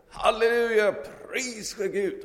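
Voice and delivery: very high, very loud Halleluja cry; shouted praise. Acted. Hippie shouting praise from down the path. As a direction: yell it from fifteen meters away. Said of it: "skrek han till".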